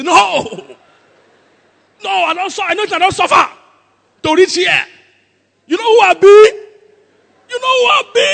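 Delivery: high, shouted comic refusal; hammed-up offended yelling; outraged Nigerian Jesus. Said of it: "if it was a Nigerian Jesus".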